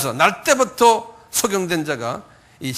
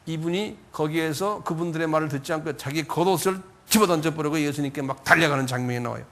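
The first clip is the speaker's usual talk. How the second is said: normal talking voice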